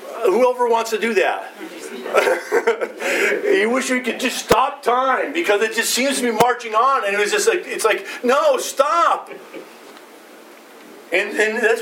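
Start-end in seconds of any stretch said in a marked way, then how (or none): 1.6-3.4 light breathy laugh, higher; amused laugh. Real, not acted. Preacher laughing at wishing to stop time. 8.2-9.3 higher, pleading "No, stop"; mock plea. Preacher pretending to beg time to stop.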